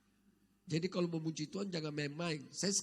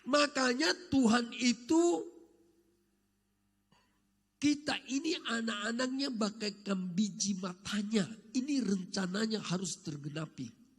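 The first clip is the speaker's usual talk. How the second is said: preaching; 4 semitones higher, 3 dB louder than usual. Acted. Preaching at full force.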